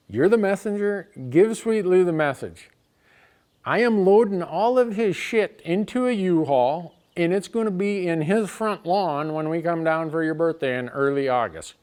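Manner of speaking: fed up, deliver the threat hard; angry ultimatum. Retold or imitated. Son-in-law threatening to dump the junk on his lawn, loud and angry.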